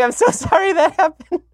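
high mock apology broken by laughter; laughing wife. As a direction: squeal it out while laughing at him. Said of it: "Like, literally laughing".

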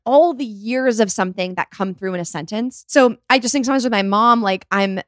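normal talking voice

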